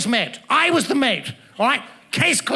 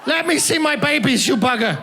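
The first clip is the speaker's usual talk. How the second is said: high, slowed, laughing mock outrage; mock custody plea; 6 semitones higher than usual. Comedian demanding visitation rights from the laughing woman, high mock plea. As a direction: plead for his laughter babies, hammed up.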